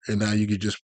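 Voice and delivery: ordinary delivery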